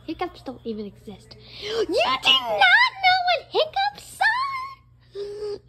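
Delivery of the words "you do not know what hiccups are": very high, drawn-out incredulous wail; gasp, then squeal the question slowly; shocked disbelief. Acted. Shocked that Tracker doesn't know hiccups, high incredulous wail.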